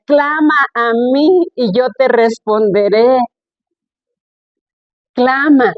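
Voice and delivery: slow, drawn-out proclamation of scripture; clamor que despierta. Real, not acted. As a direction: stretch each word out like a rousing call. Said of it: "Hasta me desperté". (proclamación lenta y sostenida que despierta)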